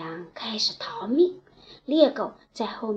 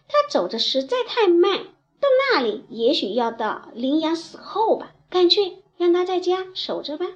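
higher, louder impatient complaint; impatient crow; 6 semitones higher, 4 dB louder than usual. Acted. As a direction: grumble it, pitched up. 乌鸦嫌乌龟慢. (不耐烦的乌鸦抱怨)

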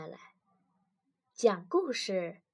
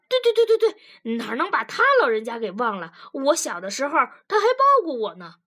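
eager agreement; 3 semitones higher, 8 dB louder, faster than usual. Acted. Husband gladly agrees about his great-aunt, eager and loud. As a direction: agree in a rapid, excited rush.

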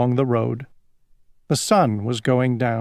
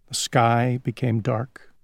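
usual speaking voice

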